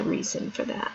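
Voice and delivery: hushed, unvoiced whisper. Shown as a demonstration. Repeating her point to the audience, whispered. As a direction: whisper it like a secret.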